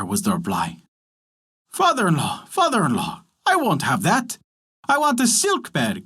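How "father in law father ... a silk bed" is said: lightly voiced frog, higher on the demand; croaking frog. A narrator's reading. Frog refuses rags and demands a silk bed, lightly voiced. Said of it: "croaked the frog".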